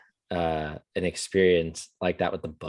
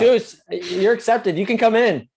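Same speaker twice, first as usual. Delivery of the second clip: calling out loud; 13 semitones higher, 5 dB louder than usual. Pretend. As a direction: holler it out to someone outside. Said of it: "Say it out loud".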